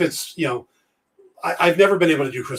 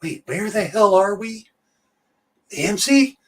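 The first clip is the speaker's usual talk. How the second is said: Reagan impression. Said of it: "Everybody goes".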